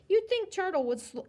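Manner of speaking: plain reading voice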